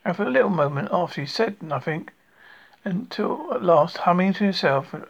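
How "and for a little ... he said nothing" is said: ordinary delivery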